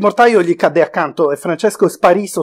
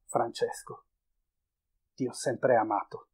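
barely audible, lower and much softer; whispered confession; 5 semitones lower, 13 dB quieter than usual. A narrator's reading. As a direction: breathe it into his ear. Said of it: "gli sussurrai all'orecchio".

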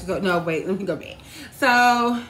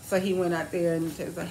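ordinary delivery

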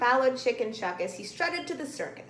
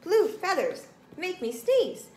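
high, comic monkey voice; high-pitched allergic little monkey; sneezy monkey; 8 semitones higher, 4 dB louder than usual. A narrator's reading. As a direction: complain between sneezes. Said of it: "The monkey blinked and began to sneeze".

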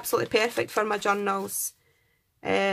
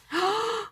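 high delighted gasp; 10 semitones higher than usual. Real, not acted. Opening a gift envelope.

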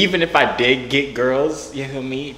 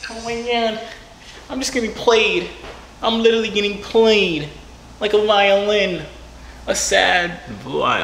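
mock lament; 8 semitones higher than usual. Complaining of getting played like a violin, high mock lament.

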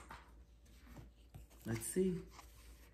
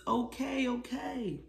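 put-on higher, giggly child voice; tickled child; 9 semitones higher, slower than usual. Little boy tickled by mom gives up, giggling. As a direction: squeal it out while being tickled.

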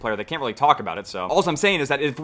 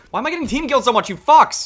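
exasperated yell; 10 semitones higher, 6 dB louder than usual. Real, not acted. Yelling at team-killing teammates.

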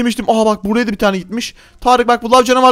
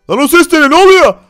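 high, loud shouts rising into a scream; panicked screaming; 8 semitones higher, 6 dB louder, slower than usual. Acted. Player hears his house blow up, panicked screaming. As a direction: yell out in escalating panic. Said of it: "[name] çok pis bağırdı".

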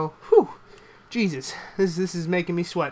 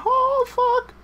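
shrieked dismay; 5 dB louder than usual. Real, not acted. Opponent hits back.

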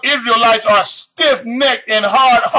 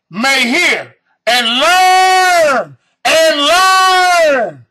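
slow, louder stretched learn; drawn-out shout; 4 semitones higher, 3 dB louder, much slower than usual. Acted. Preaching climax.

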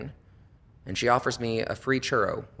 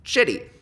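shitty hit higher and louder; punched punchline; 8 semitones higher, 4 dB louder than usual. Real, not acted. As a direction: land shitty hard after the pause. Punchline word after a pause.

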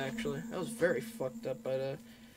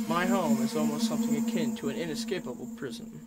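louder storybook reading of the game text; dramatic narration read; 2 semitones higher, 6 dB louder than usual. Acted. Player reads the cottage intro as dramatic narration.

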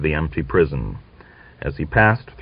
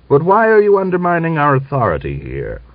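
officer protesting his authority undermined; indignant challenge; 9 semitones higher, faster than usual. Acted. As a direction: demand it angrily. Angry challenge in argument.

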